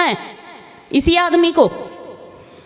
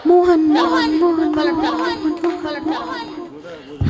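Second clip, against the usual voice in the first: mock echo; much slower than usual. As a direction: echo fading away to a whisper. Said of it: "पलट कर आवाज़". (पहाड़ी की गूंज, धीरे-धीरे फुसफुसाहट में खोती हुई)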